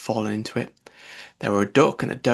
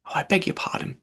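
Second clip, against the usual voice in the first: hasty apology; 5 semitones higher than usual. A narrator's reading. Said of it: "cried Alice again".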